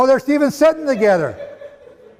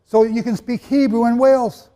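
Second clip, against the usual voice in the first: ordinary talk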